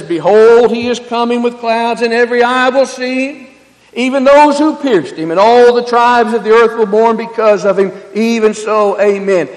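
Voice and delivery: loud, ringing scripture reading; preaching scripture aloud. Acted. Preacher reading Revelation to his congregation, loud. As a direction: proclaim it to the whole church. Reads scripture at full force.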